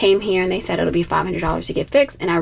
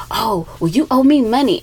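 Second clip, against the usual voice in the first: mock upset demand; 2 semitones higher, 4 dB louder than usual. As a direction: imitate the mechanic snapping back, mildly hammed-up. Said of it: "He got so upset with me".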